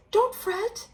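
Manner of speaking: high, soothing little mouse; squeaky mouse. Acted. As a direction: comfort the big bear like a tiny friend. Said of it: "Mouse squeaks".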